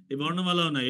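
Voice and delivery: normal talking voice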